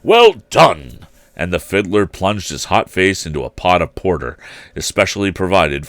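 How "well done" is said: loud, higher hearty cheer; cried out. A narrator's reading. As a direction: cheer the dancers like a proud host. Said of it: "cried out".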